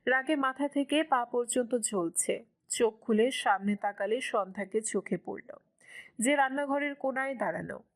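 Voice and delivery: ordinary delivery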